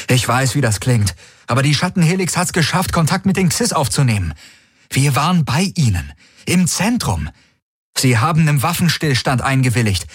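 lightly voiced urgent messenger; urgent envoy. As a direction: urge them to listen now. Garlin explains over comm.

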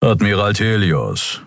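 flat growling creature voice; unimpressed growl. A narrator's reading. Skria Keru greeting the admiral unimpressed. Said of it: "knurrte der Skria namens Keru unbeeindruckt".

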